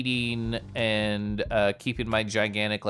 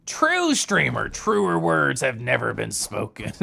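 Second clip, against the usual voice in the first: gruff hammed-up pirate; slower than usual. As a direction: growl it like an old sea dog. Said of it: "pirate voice sometimes".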